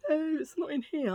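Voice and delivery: mimicked pharmacy staff.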